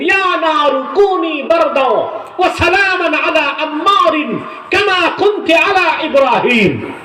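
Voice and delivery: high, ringing rebuke in Arabic; ধমক. Retold or imitated. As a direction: cry out the command at a high pitch, in forceful bursts. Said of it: "আগুনকে ধমক দিলেন". (চড়া গলায় ধমকের সুরে)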